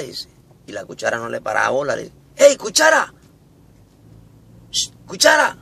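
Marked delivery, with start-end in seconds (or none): 2.3-5.6 insistent high-pitched hail; calling out. Acted. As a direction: keep calling to get attention, high. Tenedor insiste llamando. (llamando con insistencia, voz aguda)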